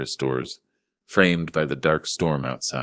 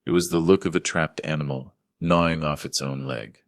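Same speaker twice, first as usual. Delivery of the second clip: ordinary delivery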